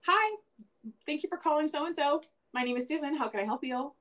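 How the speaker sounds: high, bright receptionist greeting; work phone voice. Shown as a demonstration. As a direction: switch on the polished call-center voice. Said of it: "When my work phone rings".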